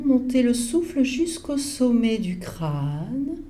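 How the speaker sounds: ordinary talk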